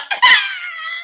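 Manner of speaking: high silly laugh noise; goofy noise. Retold or imitated. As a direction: make a dumb, high giggle sound. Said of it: "start making dumb noise".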